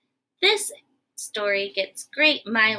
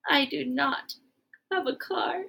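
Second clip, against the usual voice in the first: soft admission; 7 dB quieter than usual. Acted. Gerald quietly admits no car.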